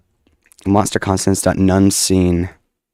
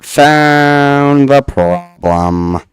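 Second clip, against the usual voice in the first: loud, very slow triumphant call; 8 semitones higher, 8 dB louder, much slower than usual. Real, not acted. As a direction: stretch it out in victory. Triumphant drawn-out announcement.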